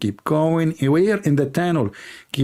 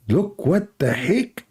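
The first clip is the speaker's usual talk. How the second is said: mock amazement; 2 semitones higher, slower than usual.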